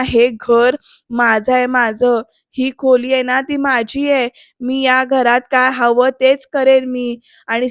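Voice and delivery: loud, emphatic scolding, claiming ownership; hammed-up angry rant, hitting each claim; angry tirade. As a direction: imitate a domineering father laying down the law. Acted angry father asserting ownership.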